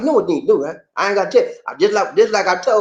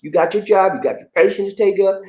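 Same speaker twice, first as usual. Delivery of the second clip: normal talking voice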